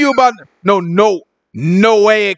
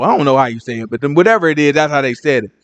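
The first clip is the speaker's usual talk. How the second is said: usual speaking voice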